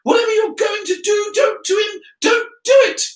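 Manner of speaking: very high, loud frantic pleading; panicked girl at the top of the voice; shrieking. A narrator's reading. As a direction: screech at a friend to stop. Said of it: "screeched, shrieked Hermi-, Hermione".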